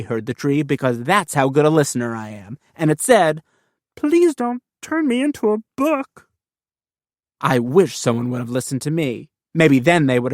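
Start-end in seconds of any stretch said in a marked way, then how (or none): 4.0-6.3 a much higher, small pleading voice; tree's plea. Acted. Kid voicing a tree begging not to become a book. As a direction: beg like a frightened little tree.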